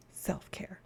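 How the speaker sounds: breathy whispered aside. Pretend. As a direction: whisper it to yourself. Whispered repeat of phrase.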